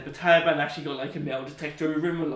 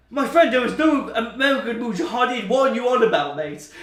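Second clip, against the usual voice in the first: high, louder mock incredulous Afghan commander; Taliban fighter's voice; 8 semitones higher, 8 dB louder than usual. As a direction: play the puzzled commander, higher and louder. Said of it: "And even the Taliban, like".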